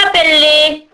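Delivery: put-on imperious old ogress voice; commanding ogress. A narrator's reading. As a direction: order it like a menacing hag. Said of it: "ordonna la vieille femme".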